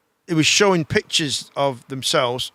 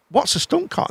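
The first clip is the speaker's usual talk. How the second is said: mock curious girl; 10 semitones higher than usual. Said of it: "we go".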